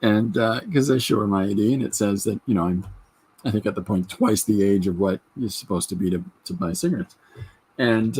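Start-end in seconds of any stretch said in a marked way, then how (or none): none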